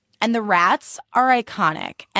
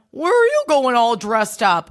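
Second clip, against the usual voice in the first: mocking snide question; 4 semitones higher than usual. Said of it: "Asking someone".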